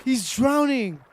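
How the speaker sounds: real panic over a drowning villager; panicked pleading. Real, not acted.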